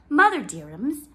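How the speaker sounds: high, loud coaxing call; wheedling child. Acted. Jem coaxing his mother for ostrich feathers. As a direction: sweet-talk mother for a favour.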